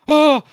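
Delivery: short shrieking high "Oh!"; a terrified yelp, mock; mock bloodthirsty scream; imitated scream. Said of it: "Niles' scream at Daphne's accent now".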